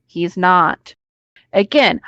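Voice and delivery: ordinary talk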